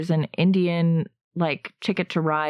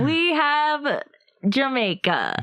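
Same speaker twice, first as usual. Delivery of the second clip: put-on Jamaican accent; 8 semitones higher, much slower than usual.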